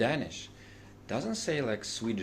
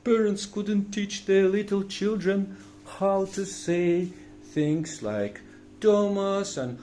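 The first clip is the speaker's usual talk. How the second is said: excited comic rant; 8 semitones higher, 6 dB louder, slower than usual. Acted.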